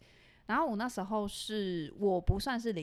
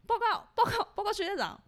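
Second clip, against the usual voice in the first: student reporting; 11 semitones higher, 3 dB louder than usual. Retold or imitated.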